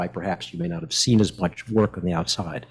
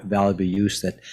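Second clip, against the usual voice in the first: ordinary delivery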